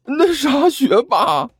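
high whiny plea; crying face. Acted. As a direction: beg him to stop through tears. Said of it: "哭丧着脸说道". (哭丧着脸央求学霸)

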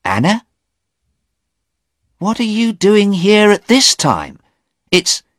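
high, loud startled question; startled young man; surprised young man. Acted. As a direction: woken too early and baffled. Sleepy Stephen surprised.

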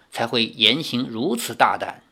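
usual speaking voice

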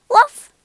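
one loud, high woof; sudden yappy bark; dog bark. A narrator's reading. Kate, under spell, barks.

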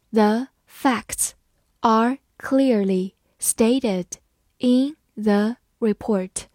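slow, phrase by phrase read-aloud. Shown as a demonstration. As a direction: read it slowly in chunks for students to follow. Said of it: "好，慢慢来读。".

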